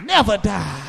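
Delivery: loud, rhythmic whooping preacher cadence; preaching at full force. Acted. Preacher to his congregation, preaching at full force. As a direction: drive each line home to the pews.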